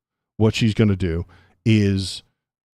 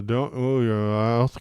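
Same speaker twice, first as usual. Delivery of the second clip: tight-lipped voice demo; 3 semitones higher, slower than usual. Shown as a demonstration. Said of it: "kind of a voice".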